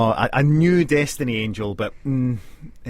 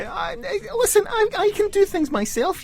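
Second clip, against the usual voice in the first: high, indignant, mock offended; indignant protest. Real, not acted. Protesting being doubted.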